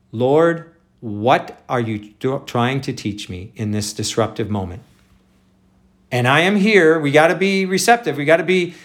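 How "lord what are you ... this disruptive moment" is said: humble prayer. Shown as a demonstration. Preacher showing the right prayer, soft and humble. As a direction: quietly open your hands to the Lord. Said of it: "But rather, the key is".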